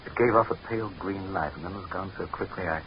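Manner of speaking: soft, uneasy report; half-spoken, unsettled; hushed unease. Acted. Uneasy sighting in the dark.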